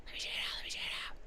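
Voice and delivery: hushed, whispered aside; whispering to self. Real, not acted. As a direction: whisper it quickly under your breath. Whispered muttering while checking message.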